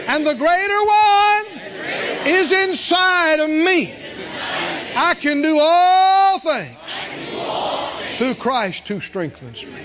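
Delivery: slow soaring declaration of faith; preaching shout. Real, not acted. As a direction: ring it out like a revival preacher.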